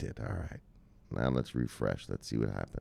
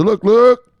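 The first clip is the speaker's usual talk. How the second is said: high, loud look look; hype catchphrase; 12 semitones higher, 11 dB louder than usual. Real, not acted.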